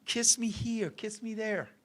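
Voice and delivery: very soft, intimate instruction; hushed seduction. Retold or imitated. Woman guiding inexperienced lover where to kiss, hushed and coaxing. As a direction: imitate a lover coaxing, barely voiced. Woman coaching him, imitated.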